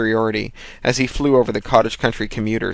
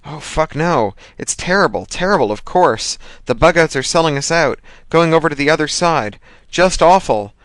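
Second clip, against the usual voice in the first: higher, louder mock horror; gleeful mock outrage; 6 semitones higher, 4 dB louder than usual. A narrator's reading. Thomas pretends the news is awful to Hershey. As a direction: fake dismay hiding delight.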